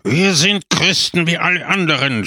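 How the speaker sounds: storybook shout, held a little in check; indignant cry. A narrator's reading. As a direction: cry out in protest as an angry character. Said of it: "schrie Pepone".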